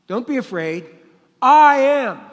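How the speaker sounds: "I am" rises high and booming, slow; emphatic proclamation. Acted. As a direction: proclaim "I am" at full force like a preacher. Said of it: "So Jesus is saying".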